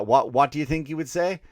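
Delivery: normal talking voice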